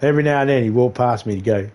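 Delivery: usual speaking voice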